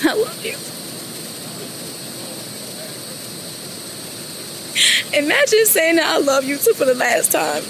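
high, broken, sobbing through words; crying out love and sorry; tearful goodbye. Real, not acted. Daughter's last goodbye to father.

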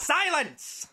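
high, loud order for silence; commanding shout. Acted. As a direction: bark it to end the quarrel. Boss halts the quarrel.

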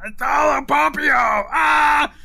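high, loud, desperate wail as he dies; anguished dying cry. Acted. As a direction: cry out in terror as the light pours out. Dying man cries out.

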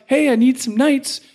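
lightly voiced king asking for help; pleading king. King of France asking castellans for knights, lightly voiced.

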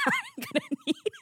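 fast, high words breaking into laughter; laughing. Real, not acted. Host telling wedding hosts to bring tissues, laughing. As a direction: laugh it out as you say it.

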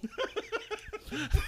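high, soft wheezing laugh; helpless laughter. Real, not acted. Laughing at the salt and pepper mix-up, high wheezy laughter. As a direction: laugh till the breath runs out.

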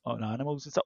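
sheepish mumble. Retold or imitated. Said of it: "he said".

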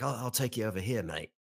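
imitated Australian shop worker; mock Australian, helpful offer; Australian accent. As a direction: put on an Aussie accent. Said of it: "All right, now he's Aussie".

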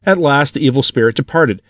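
ordinary delivery, nothing marked

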